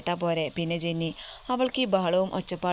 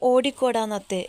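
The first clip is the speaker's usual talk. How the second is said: a sudden loud yelp, pitched up; startled shout; 5 semitones higher, 6 dB louder than usual. Acted. Jenny yelping at her sister-in-law as she runs off, loud shout. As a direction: shout it as you bolt.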